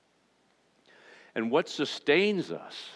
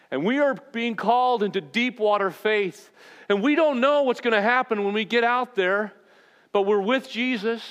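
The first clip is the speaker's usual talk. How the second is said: full-force preaching; 11 semitones higher, 5 dB louder than usual. Real, not acted. Rising sermon climax.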